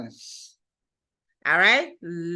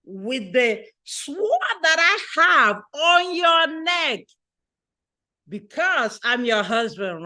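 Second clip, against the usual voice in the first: ordinary talk, nothing marked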